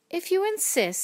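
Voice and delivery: high, lilting storybook reply; obliging reply. A narrator's reading. Creature accepting the ladybug's challenge, high and lilting.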